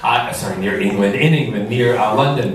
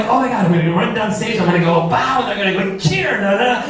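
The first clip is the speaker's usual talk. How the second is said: excited; 7 semitones higher, faster than usual. Retold or imitated. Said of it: "And I'm like".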